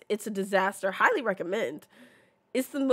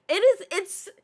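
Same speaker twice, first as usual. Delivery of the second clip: choked up; 8 semitones higher, 6 dB louder than usual. Real, not acted. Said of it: "I can't even get through this".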